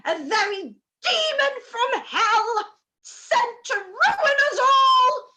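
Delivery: wailing lament. Acted. Mother wailing to her sister about Wickham. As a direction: wail it out as if the family is ruined.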